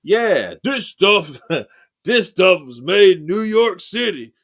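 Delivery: loud, high ad-voice imitation; commercial quote. Imitating a salsa commercial to his friend Kurt, loud and high. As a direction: mimic an old salsa commercial. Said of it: "that salsa commercial that said".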